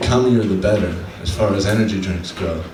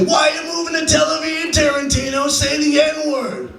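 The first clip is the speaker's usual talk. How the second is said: heckling woman. Retold or imitated. Comic mimics a woman heckling Tarantino at dinner, high mock shriek. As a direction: squeal it out like an outraged heckler, drawing out the name. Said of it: "They say".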